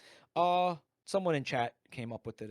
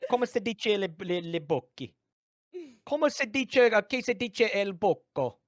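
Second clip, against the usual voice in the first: put-on high, loud Italian accent; hammy Italian accent; 5 semitones higher, 5 dB louder than usual. Mock Italian phrasing.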